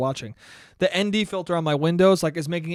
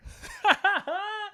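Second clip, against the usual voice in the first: high giggle at oneself; light high-pitched laugh; tickled, self-mocking giggle; 4 dB quieter than usual. Real, not acted. Laughs at own impatience.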